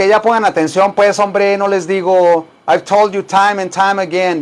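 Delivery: usual speaking voice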